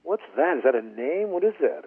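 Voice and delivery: retold bafflement, high and loud; what-on-earth incredulity, played for the story; baffled disbelief. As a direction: act out puzzled questioning with emphatic pitch jumps. Retelling his baffled reaction to name.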